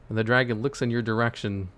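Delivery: usual speaking voice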